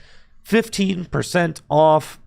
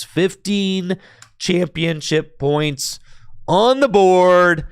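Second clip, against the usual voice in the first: excited play-by-play; 4 semitones higher than usual. Acted. Stream caster calling the tournament-winning turn, excited. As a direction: call the final knockout like a live caster.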